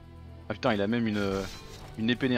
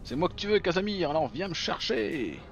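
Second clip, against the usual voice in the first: defiant hero taunt; 7 semitones higher than usual. Acted.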